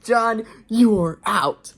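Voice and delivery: slow, loud, high furious shout. A narrator's reading. Dan throwing John out, shouting furiously. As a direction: shout each word as a final verdict. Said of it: "Dan was furious".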